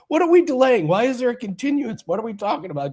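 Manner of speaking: high, loud indignant squeak; hammed-up livid Wawa rep; mock outrage. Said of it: "He was like".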